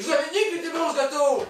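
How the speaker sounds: loud high shout. Real, not acted. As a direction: yell it across the table. Kid shouting across the table.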